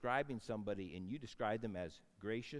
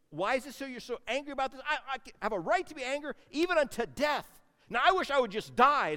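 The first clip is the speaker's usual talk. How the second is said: sulky Jonah; 11 semitones higher, 9 dB louder, faster than usual. Acted. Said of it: "God just dialogues with him".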